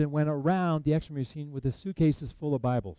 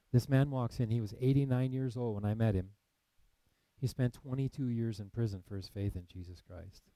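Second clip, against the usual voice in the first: normal talking voice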